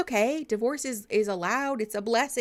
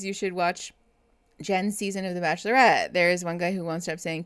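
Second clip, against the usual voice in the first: ordinary talk, nothing marked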